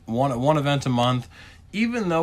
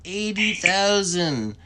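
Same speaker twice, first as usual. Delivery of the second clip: slow, high, drawn-out mock whine; mock whining gamers; 7 semitones higher, much slower than usual. Mimicking players griping about the eighty K event, slow high mock whine. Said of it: "They're like".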